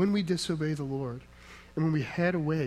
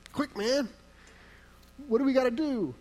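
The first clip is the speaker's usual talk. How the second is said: high, urgent plea; panicked sailors; 8 semitones higher than usual. Retold or imitated. Pastor voices panicked sailors shaking Jonah, urgent high plea. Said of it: "Is their attitude".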